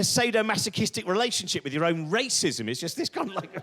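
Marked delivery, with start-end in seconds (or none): none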